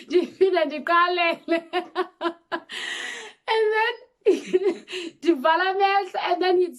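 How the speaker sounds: words squeezed out between high laughs; cracking up while telling it; laughing hard. Real, not acted. Telling viewers the story, laughing helplessly.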